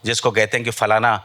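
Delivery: ordinary talk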